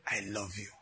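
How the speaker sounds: low, soft quoted "I love you"; sarcastica, sottovoce. Retold or imitated. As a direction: drop low and soft, imitating the girl's punchline. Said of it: "with a lot of sarcasm".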